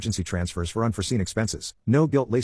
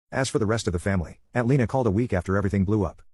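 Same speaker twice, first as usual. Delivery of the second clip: ordinary delivery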